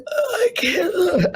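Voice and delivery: strained, breaking high croak; mock a voice that is giving out; losing voice. She mimics her voice failing while reading scriptures. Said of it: "I was losing my voice".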